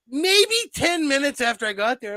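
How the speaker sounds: normal talking voice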